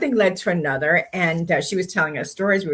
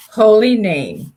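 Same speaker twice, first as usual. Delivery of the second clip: Holy Name drawn out slowly and louder as a big reveal; slow dramatic reveal; 3 semitones higher, 6 dB louder, much slower than usual. Real, not acted. To her co-host, revealing the nurse's church, slow and emphatic. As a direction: let Holy Name land, slow and weighty, like a punchline.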